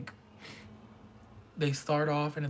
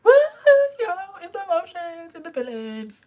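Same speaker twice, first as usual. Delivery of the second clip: mock emotional crowd; 9 dB louder, faster than usual. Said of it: "where everyone's just like".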